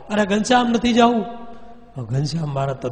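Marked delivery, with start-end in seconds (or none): 0.1-1.3 pleading. A narrator's reading. Mother begging son not to go.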